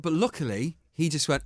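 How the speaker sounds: normal talking voice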